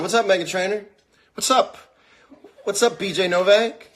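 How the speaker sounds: high, loud shout-out; excited greeting. Real, not acted. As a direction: call out in surprise to famous guests joining. Spots famous viewers and greets them.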